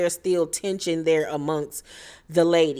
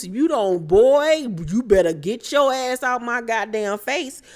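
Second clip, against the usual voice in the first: mock threat; 4 semitones higher than usual. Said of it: "I would've told Deuce".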